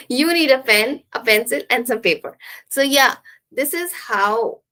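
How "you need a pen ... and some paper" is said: flowing list, up on "pen", down on "paper"; rising and falling. Shown as a demonstration. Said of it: "rising and falling".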